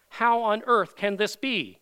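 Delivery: loud, slow incredulous question; emphatic disbelief. Acted. Preacher puzzling over Jesus' amazing statement, emphatically incredulous. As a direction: pound out the astonished question.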